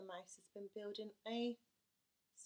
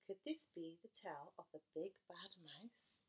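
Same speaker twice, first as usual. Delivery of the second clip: suspense whisper; 3 semitones lower, 5 dB quieter than usual. Acted. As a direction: hush the listeners with the question.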